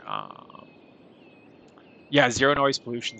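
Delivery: normal talking voice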